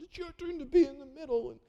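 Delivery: put-on high whining kid voice; whiny child. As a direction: whine it like a little kid.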